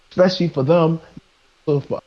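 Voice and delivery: ordinary delivery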